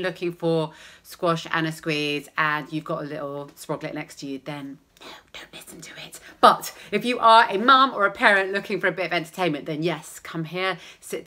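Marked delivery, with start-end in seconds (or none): 5.0-6.2 whispered aside to parents. Real, not acted. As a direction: secret whisper to the grown-ups.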